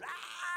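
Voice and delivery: very high mock shriek; short high screech, not full volume; imitated scream. Addict bolt upright screaming at the pastor, imitated shriek. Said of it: "he started screaming at me at the top of his voice".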